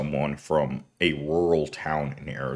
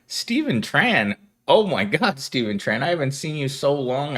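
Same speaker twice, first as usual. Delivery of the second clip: light up at a familiar name; excited greeting; 7 semitones higher than usual. Real, not acted. Greeting returning viewer Stephen Tran, delighted.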